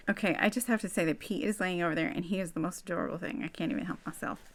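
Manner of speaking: soft, hurried Southern accent. Real, not acted. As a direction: gush quietly in a slipped-in Southern accent. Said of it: "I don't know why I do a southern accent".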